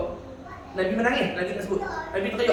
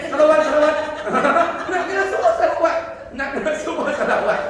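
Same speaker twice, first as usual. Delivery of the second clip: laughing urging; 6 semitones higher, 4 dB louder than usual. Real, not acted. Preacher urging congregation.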